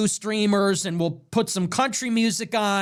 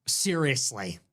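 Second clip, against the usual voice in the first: normal talking voice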